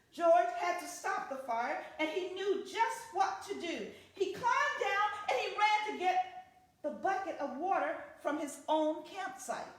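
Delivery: ordinary delivery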